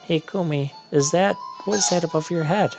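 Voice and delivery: lightly hurried, high game-character read; acted cutscene witch. Voicing witch Kotake in cutscene.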